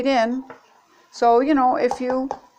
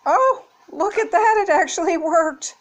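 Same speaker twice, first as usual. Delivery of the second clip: high, louder exclamation of amazement; real delighted surprise; 10 semitones higher, 4 dB louder than usual. Real, not acted. Vlogger sees the egg gadget actually work, real surprise. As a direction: pitch leaps up in happy disbelief.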